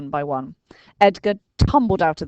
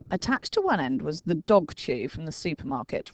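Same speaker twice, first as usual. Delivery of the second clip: ordinary delivery, nothing marked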